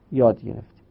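ordinary delivery

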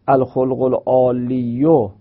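العالیو ending in an audible "-yu"; underlying form. Shown as a demonstration. Teacher repeats the original form, damma sounded on العالیو. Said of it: "و اصلش اونطوری بوده".

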